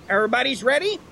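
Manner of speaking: ordinary talk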